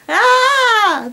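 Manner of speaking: hammed-up high cry; high moan. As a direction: moan out loud, overacted.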